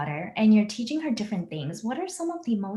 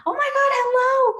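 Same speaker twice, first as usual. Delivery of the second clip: high, loud, gushing hello; exclamation-mark greeting; 8 dB louder than usual. Retold or imitated. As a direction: squeal it like a string of exclamation marks. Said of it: "exclamation, exclamation".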